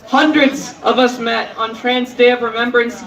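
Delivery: normal talking voice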